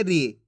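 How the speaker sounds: brief, low falling "री"; "री" kept short as a reading model; short i sound. Shown as a demonstration. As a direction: say "री" with a short vowel, dropping in pitch. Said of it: "इसके उच्चारण में कम समय लगेगा".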